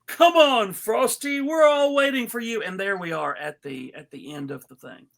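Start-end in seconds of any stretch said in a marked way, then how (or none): 0.1-2.6 jolly Santa voice. Acted. Santa calling Frosty to come along, jolly and loud. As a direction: boom it out like a cheerful Santa. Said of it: "Santa goes".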